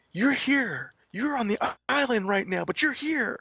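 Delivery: high, thrilled squeal of disbelief; mock excited fan shrieking. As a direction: gush like a starstruck fan. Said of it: "she's screaming".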